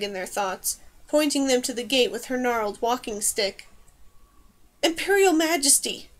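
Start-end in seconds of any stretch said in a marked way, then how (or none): 4.8-6.1 higher-pitched urgent call; lightly acted cry for attention. A narrator's reading. Nobleman to the Emperor amid the chaos, crying out. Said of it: "someone cried".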